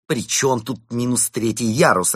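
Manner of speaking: loud, slow irritated question; angry. Acted. Inventor irritated by the question about the level, loud and slow. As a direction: snap back in annoyance. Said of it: "рассердился брат Бенедикт".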